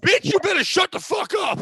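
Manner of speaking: high, loud, play-acted outburst; mock shouting. Comic replaying the bus fight with his co-host, mock shouting. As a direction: holler it like a squabbling bus passenger. Re-enacting the bus quarrel as banter.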